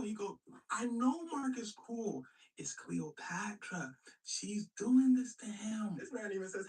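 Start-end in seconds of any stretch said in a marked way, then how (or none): 0.7-6.0 slow, drawn-out accusing speech; emphatic accusation. Retold or imitated. Octavius blaming Cleopatra for Mark, emphatic accusation. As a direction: spell it out slowly for the crowd.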